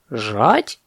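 astonished rising question; surprised parrot. Acted. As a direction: echo the word in mild disbelief. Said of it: "Попугай так удивился".

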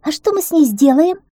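high, loud wondering voice; squirrel's high-pitched puzzlement; surprised question. A narrator's reading. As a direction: ask in open amazement.

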